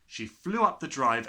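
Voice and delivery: ordinary delivery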